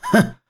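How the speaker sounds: short, high scoffing snort; cold sneering laugh. Acted. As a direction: scoff coldly through the nose. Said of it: "贼人冷笑道". (冷笑一声)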